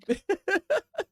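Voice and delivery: high-pitched burst of laughter; quick, high cackling laugh. Real, not acted. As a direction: crack up in a high giggle. Reacts to the bribe offer.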